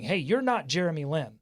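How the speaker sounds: ordinary talk, nothing marked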